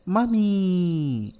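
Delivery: high, loud, drawn-out call; child calling. A narrator's reading. Birthday girl tugging mother's clothing, calling out. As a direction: stretch it out to get mother's attention. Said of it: "called, pulling her mother's clothing".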